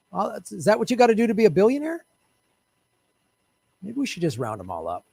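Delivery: ordinary talk, nothing marked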